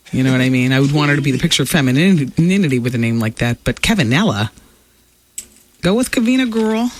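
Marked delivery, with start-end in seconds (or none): 5.8-6.9 sassy. Real, not acted. Sassy advice to Britney.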